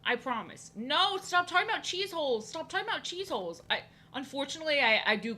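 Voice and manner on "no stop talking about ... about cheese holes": high-pitched protest to the chat; mock scolding. Real, not acted. Streamer telling chat to stop talking about cheese holes, high mock protest.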